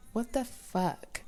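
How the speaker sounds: slow, soft muttered swear; quiet muttered curse. Real, not acted. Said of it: "See, I can't even talk".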